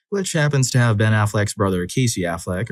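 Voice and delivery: ordinary delivery, nothing marked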